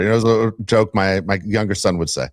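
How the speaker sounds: ordinary delivery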